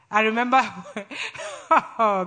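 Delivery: ordinary talk, nothing marked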